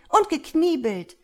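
loud, high, outraged addition; indignant shouted reproach; accusing cry. A narrator's reading. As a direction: hurl the second charge harder. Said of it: "rief sie anklagend".

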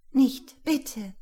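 high loud pleading cry; frightened plea. A narrator's reading. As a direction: beg him to stop, alarmed. Startled woman pushing him away. (ängstliches Flehen)

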